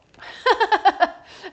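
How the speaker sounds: high, loud burst of laughter; big cackling laugh. Real, not acted. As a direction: crack up laughing. Laughs after acted no.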